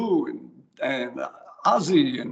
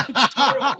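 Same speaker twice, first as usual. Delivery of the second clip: laughing; 9 semitones higher, 3 dB louder, faster than usual. Real, not acted. Laughing at own French.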